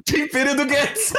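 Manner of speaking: high, slow laughing exclamation; laughing exclaim. Real, not acted. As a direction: burst out laughing as you say it. Cracking up at joke.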